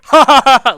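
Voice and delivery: hammed-up big belly laugh; loud mock laugh. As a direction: blast out a friend's loud theater laugh. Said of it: "like, real loud".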